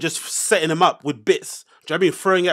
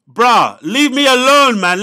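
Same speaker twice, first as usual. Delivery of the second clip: loud, higher-pitched outburst; exasperated protest; 6 semitones higher, 8 dB louder, much slower than usual. Real, not acted. Podcaster answering critics of his pronunciation, exasperated protest. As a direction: protest loudly at being picked on.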